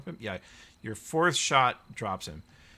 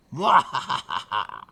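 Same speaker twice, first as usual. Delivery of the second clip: laugh; 2 semitones higher, 10 dB louder than usual. Real, not acted. GM laughing before the voice.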